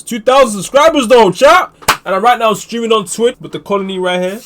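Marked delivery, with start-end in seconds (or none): 0.0-1.7 loud, high call to the crowd; excited hype. Real, not acted. Hyping up the chat.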